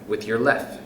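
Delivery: usual speaking voice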